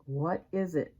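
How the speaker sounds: ordinary delivery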